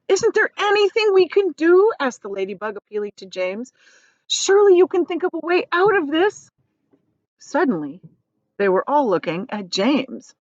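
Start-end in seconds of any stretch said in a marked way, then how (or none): 0.1-6.5 high, loud pleading; desperate plea. Acted. Ladybug appealing to James, high and desperate. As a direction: beg for a way out.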